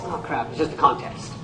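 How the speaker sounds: soft, low, bored mutter; jaded. Retold or imitated. Audience member shrugging off a new contest, bored mutter. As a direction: shrug it off, unimpressed. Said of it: "you go like this".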